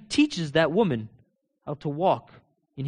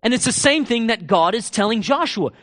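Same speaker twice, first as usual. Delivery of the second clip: ordinary talk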